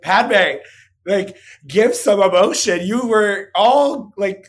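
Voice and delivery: loud, fed-up mock rant; exasperated outburst. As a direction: throw up your hands and demand some feeling. Exasperated at the character.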